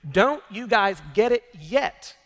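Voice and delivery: Jesus scolding his disciples in frustration; frustrated outburst. Acted. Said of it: "as if in frustration he says".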